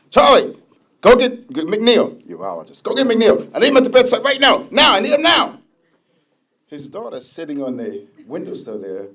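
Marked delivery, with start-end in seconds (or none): 0.1-5.6 high, loud demanding shout; retold yell. Sick mentor yelling at Charlie to fetch the urologist, loud mock yell. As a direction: bark orders like an urgent patient. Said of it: "So he's yelling at me".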